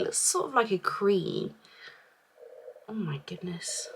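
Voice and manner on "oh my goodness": soft awed whisper. Real, not acted. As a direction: breathe it out in wonder. Awed at product texture.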